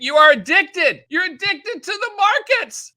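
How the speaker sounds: high, loud acted outcry; hammed-up, drawn-out intervention plea; mock impassioned accusation. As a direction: read the letter like a pleading accusation, pitch leaping up. Acting out family's intervention letter.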